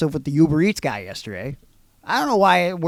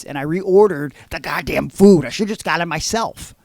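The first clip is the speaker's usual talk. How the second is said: ordinary delivery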